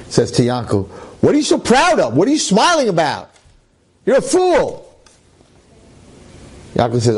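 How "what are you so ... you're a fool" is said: sneering taunt, pitched higher; mocking. Satan taunts Yankel, a sneering mocking voice. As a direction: scoff at him like a tempter.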